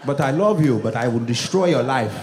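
put-on stern, accented immigrant father; mock-menacing father lecture; imitated strict dad. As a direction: lay down the law as an unbending dad. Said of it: "one of those dads who's like".

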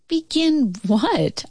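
high, slow, wary question; a little scared. A narrator's reading. Child questions dad at the pit, slightly scared and hesitant. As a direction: stretch it out with a touch of fear. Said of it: "still a little scared".

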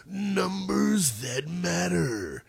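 very slow, drawn-out announcer impersonation; hammed-up show-title announcer, softly stretched; big voice guy. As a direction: draw every word out like a big-voiced promo guy. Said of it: "my big voice guy impersonation".

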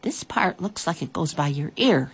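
plain reading voice

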